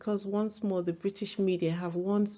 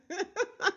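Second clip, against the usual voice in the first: embarrassed laugh; 11 semitones higher, 4 dB quieter than usual. Real, not acted. Laughs at own impression.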